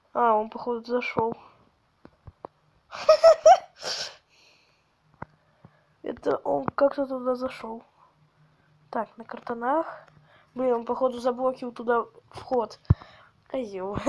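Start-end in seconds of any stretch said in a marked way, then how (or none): 2.9-3.6 sudden high squeal of laughter; loud shrill laugh; high burst of laughter. Real, not acted. Laughs at opponent. 13.5-14.0 whispered insult. Real, not acted. Insult under breath.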